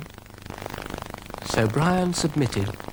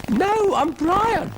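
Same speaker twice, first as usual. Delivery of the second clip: very high, slow, emphatic pipe; insistent little snail; 4 dB louder, slower than usual. Acted. Brian tells Ermintrude who he is, high insistent voice. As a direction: draw it out in a tiny high voice.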